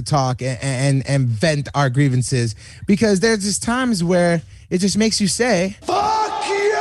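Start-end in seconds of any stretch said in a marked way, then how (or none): 5.8-6.7 high, sustained shout; theatrical full-throated holler; drawn-out yell. Acted. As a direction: scream the catchphrase to vent, stretching it out. Venting, film rant catchphrase yelled.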